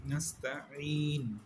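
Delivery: নাস্তাইন recited slowly with a long final ন; slow recitation. Shown as a demonstration. Teacher modelling the word for students. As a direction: draw out নাস্তাইন like Quran recitation. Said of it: "তারপর হচ্ছে".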